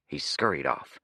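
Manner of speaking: usual speaking voice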